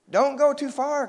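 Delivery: high, loud warning call; crying out. A narrator's reading. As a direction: call after him in alarm. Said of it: "cried Jack".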